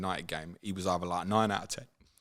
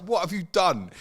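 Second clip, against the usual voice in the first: mock exasperated teammate; 10 semitones higher, 5 dB louder than usual.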